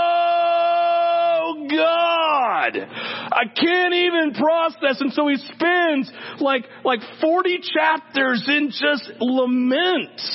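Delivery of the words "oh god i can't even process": slow, raised, anguished plea; anguished cry to God. Retold or imitated. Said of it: "but he's like".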